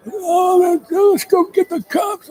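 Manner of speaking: high shouted drunk impression; nasty drunk. Drunk old acquaintance egging on the van, nasty drunk holler. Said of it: "a real nasty drunk".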